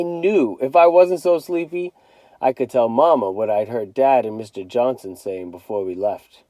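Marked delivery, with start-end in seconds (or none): none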